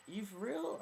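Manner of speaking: high, loud disbelieving question; incredulous reaction. Retold or imitated. Replying to the chat-up guy, incredulous. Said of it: "I'm like".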